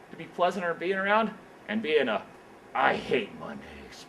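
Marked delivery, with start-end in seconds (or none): 2.7-4.1 much lower, slow grumbling put-on voice; grumpy worker. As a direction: sulk it like a dragging Monday grouch.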